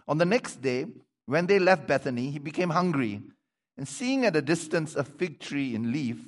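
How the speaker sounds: emphatic, projected Bible reading; scripture reading. Acted. Preacher reading the fig tree and temple story, emphatic projected voice. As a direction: read it out with strong emphasis on key words. Reads scripture aloud to congregation.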